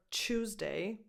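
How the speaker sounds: Tuesday with a British tyoo start. Shown as a demonstration. Teacher showing Italian learners the British Tuesday. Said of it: "more on the British side".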